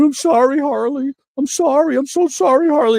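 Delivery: put-on high, whimpering apology like a child; whiny pleading. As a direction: beg like a scolded kid. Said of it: "Vader's going".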